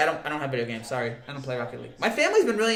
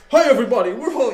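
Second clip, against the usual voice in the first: loud, higher-pitched comic impression; Rodney Dangerfield; 6 semitones higher, 8 dB louder than usual. Streamer trying a Rodney impression for chat, loud comic impersonation. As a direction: burst in loudly like a stand-up comic. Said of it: "I'm trying to do Rodney".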